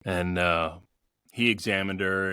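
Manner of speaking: normal talking voice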